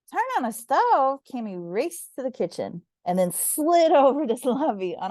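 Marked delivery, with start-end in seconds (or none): 0.1-1.2 alarmed disbelief. A narrator's reading. Cammy alarmed at request.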